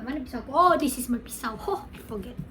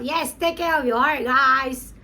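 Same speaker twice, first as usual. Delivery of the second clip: emphatic repeat; 6 dB louder than usual. Real, not acted. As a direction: urge the fans loudly. Repeats the line excitedly to viewers.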